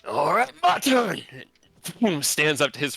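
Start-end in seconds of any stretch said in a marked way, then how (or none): 0.1-1.2 high, loud eager cry; louder and higher, gleeful challenge; battle shout. Acted. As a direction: bellow it as you rise. Eric rising for attack.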